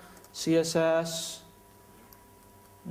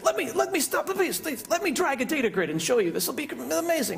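high, eager pitchman delivery; salesy presenter; 9 semitones higher than usual. Retold or imitated. Imitating local Microsoft guys hyping a demo. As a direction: hype the demo like a huckster. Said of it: "the local Microsoft guys always like that".

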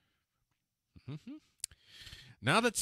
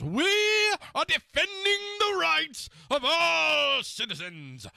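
mock pompous orator; 5 semitones higher, 7 dB louder than usual. Reading out the Prime Minister's speech.

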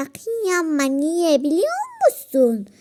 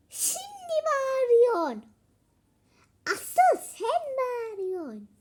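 indignant; 9 semitones higher than usual. Pretend. Quarrel, throwing blame back.

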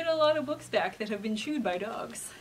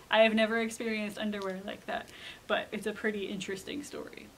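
usual speaking voice

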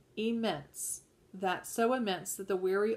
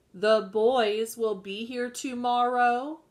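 louder and slower, with a lift at the end; repeating it more loudly to wake him; a little louder; 3 semitones higher, 7 dB louder, slower than usual. Acted. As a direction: say it again to rouse her sleeping husband. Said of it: "a little bit more loudly".

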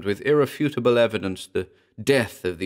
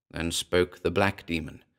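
plain reading voice

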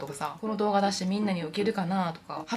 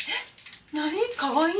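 flustered coy reaction; 9 semitones higher, much slower than usual. Pretend. A girl flustered by her boyfriend's tender look, coy. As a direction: act bashful at being called cute. Imagining a man's fond look.

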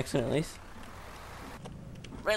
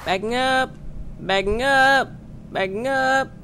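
louder, higher call-out; mock reversing call; 7 semitones higher, 7 dB louder, slower than usual. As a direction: sing out the warning like a reversing signal. Calling out while reversing the bus.

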